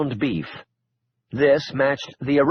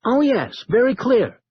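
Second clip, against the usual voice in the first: cheerful techie; 9 semitones higher than usual. Acted. Milton cheerful reply.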